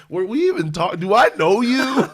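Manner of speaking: high, loud, laughing disbelief; mock bewildered do I know you; incredulous. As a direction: act baffled and amused at a stranger. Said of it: "And I'm just like".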